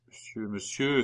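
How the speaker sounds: ordinary delivery, nothing marked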